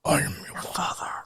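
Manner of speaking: breathy whispered villain line; Darth Vader. Retold or imitated. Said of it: "Darth Vader only says".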